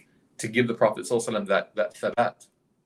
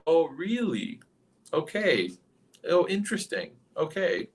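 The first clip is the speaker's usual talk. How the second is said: higher-pitched sarcastic interest; mock disbelief; 6 semitones higher, slower than usual. Abu Jahl reacting to the night journey claim, mockingly skeptical. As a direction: humour him as if none of it is believable. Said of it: "starts laughing. And he just starts saying".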